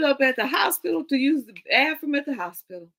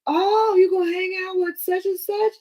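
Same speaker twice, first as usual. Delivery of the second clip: higher, louder alarmed cry; alarmed aunt; 9 semitones higher, 4 dB louder than usual. Retold or imitated. Aunt on the phone alarmed by the plan, higher shocked cry.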